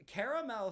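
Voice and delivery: "caramel" in full, CARE-a-mel; pretentious caramel. Shown as a demonstration. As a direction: give "caramel" its second a. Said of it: "sounds very pretentious".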